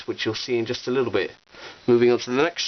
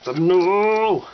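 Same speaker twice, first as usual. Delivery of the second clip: Gandalf yelling; 7 semitones higher, 5 dB louder than usual. Retold or imitated. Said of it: "Gandalf's spinning around like".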